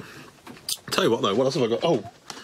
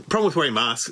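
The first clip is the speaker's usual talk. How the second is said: usual speaking voice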